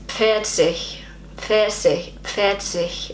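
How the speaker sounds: careful learner's German "Pfirsich"; attempted German pronunciation. Shown as a demonstration. Learner practising a difficult German word, careful attempt. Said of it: "particularly difficult words to pronounce".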